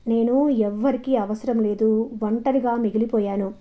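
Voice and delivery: plain reading voice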